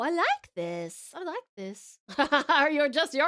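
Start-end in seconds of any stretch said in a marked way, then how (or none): 0.0-1.1 savoring drawl. Real, not acted. Reacting to the song. 1.1-2.0 soft repeat. Real, not acted. Reacting to the song.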